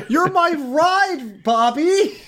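high, slow plaintive whine; mock pleading. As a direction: whine it at your ride. Acting a stranded guest pleading.

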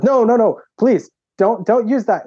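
pleading principal. Retold or imitated. Principal pleading in retold story.

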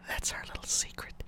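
soft conspiratorial whisper. Real, not acted. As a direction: whisper it like a shared secret. Sharing a secret aside.

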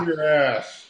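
General Patton voice, pipe between teeth; pipe in mouth. Pretend. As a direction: talk around a pipe stem. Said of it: "I got my pipe in my mouth".

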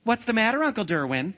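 put-on high, loud small-boy call; boy's voice. A narrator's reading. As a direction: pipe up like a child calling from below. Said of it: "it sounded like the voice of a boy".

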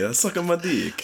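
high, slow phony Italian; mock Italian accent. Correcting his friend's Italian line, mock Italian accent. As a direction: draw it out in a silly Italian accent. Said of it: "No, you have to say".

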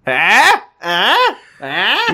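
rising, insistent joke prods; mock nudging. Co-host piling onto the dad joke, mock nudging. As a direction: prod for a laugh with each one.

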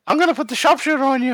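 fast, high mock wrestler threat; wrestler trash talk. Co-host joining the bit, threatening an imaginary opponent: fast, high mock wrestler voice. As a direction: rattle off a cartoon heel threat, pitched well up.

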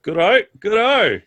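high, chirpy good-o; chuffed tavern keeper; pleased landlady. Acted.

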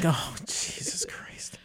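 breathy stunned whisper of disbelief; hushed, appalled aside; exasperated whisper. Retold or imitated. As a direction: whisper it like the news just hit you. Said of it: "and I'm like".

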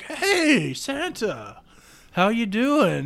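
high, singing-out greeting; friendly call-out. Acted. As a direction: call over to him, pitched well up. Elves calling out to Santa.

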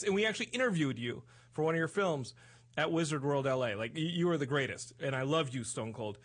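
ordinary delivery

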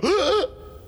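high gasp of horror; sharp shocked gasp. Acted. Amar seeing the bones crunched, sharp gasp. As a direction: gasp at the desecration. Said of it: "I go".

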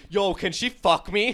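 loud, slow mock bro voice; loud mocking bro impression; mocking shout. Mocking friend's reaction.

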